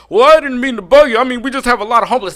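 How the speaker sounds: high, fast apologetic mimicry; mock apologetic groundskeeper. Groundskeeper backing off, high and apologetic. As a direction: ham up his fluster. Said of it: "Then he's like".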